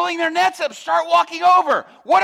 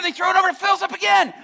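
ordinary talk, nothing marked

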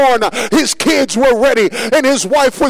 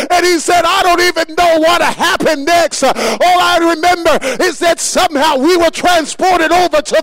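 preaching climax; 6 semitones higher than usual. Acted. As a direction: build and build until the church is on its feet. Sermon climax, testimony shouted.

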